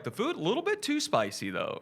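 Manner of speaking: high-pitched mock American politeness; hammed-up high American voice; job interview voice. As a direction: complain daintily in a put-on proper voice. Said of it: "do your job interview voice".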